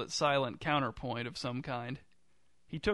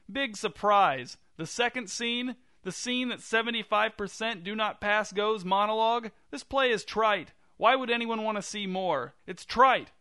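high, raised-voice rant; shouted; 8 semitones higher, 5 dB louder than usual. A narrator's reading. Jerry rebelling on stage against the demand, shouted. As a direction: shout a scornful protest, pitched high. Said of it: "Jerry shouted".

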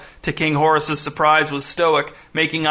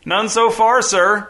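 higher-pitched call back; shouted answer; 6 semitones higher, 3 dB louder, slower than usual. A narrator's reading. Crewman answers the boss on deck, calling up. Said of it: "shouted a fat man".

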